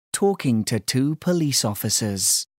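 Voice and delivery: plain reading voice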